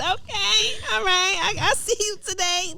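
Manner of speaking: very high, drawn-out playful hollering; hyped-up cheering. Retold or imitated. Hyping herself up at the mirror, excited high cheering. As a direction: whoop like your own hype girl.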